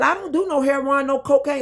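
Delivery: high, insistent protest; indignant denial. Retold or imitated. Telling the doctor she takes no drugs, high indignant.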